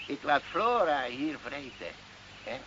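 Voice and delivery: ordinary delivery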